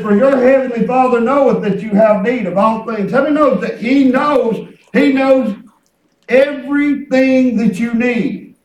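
loud exhortation with stretched words; fervent preaching. Real, not acted. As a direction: drive each phrase home to the congregation. Preaches with full voice.

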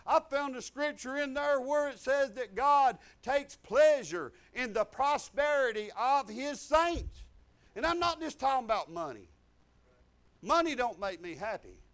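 loud, slow, ringing sermon voice; full-force preaching. Real, not acted. Preacher proclaiming scripture to the congregation, loud and slow. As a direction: lift the scripture over the crowd.

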